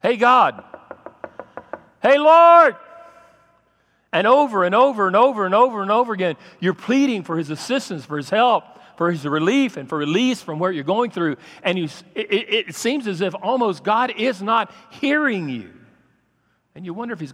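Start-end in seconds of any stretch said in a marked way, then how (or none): 0.0-2.8 loud, rising cry to God, much higher the second time; louder and higher calling "Hey, Lord"; desperate calling out. Acted. Pleading call to God. 4.1-15.7 loud, fast, driving preacher voice; fervent loud sermon build; preaching at full force. Acted. Pleading passage, preached hard.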